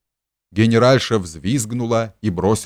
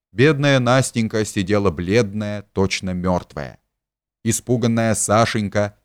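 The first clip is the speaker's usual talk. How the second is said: ordinary delivery, nothing marked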